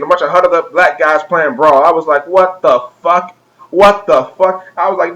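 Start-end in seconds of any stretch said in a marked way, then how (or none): 2.3-4.7 slow, drawn-out mock astonishment; slowly aghast, acted out; retold disbelief. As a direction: stretch each word out in stunned disbelief. Retells stunned reaction to gamers.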